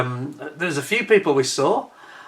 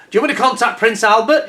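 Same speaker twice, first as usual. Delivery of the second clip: raised, louder mock-furious threat; indignant outburst; 8 semitones higher, 5 dB louder than usual. Guest threatens hotel manager with Prince Albert, mock outrage. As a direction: pitch it high like an outraged guest calling on royalty. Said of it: "I went crazy and I said".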